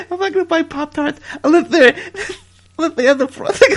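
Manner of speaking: can't hold it together while quoting; giggling through the wrestler's line; laughing. Real, not acted. Cracking up mid-quote.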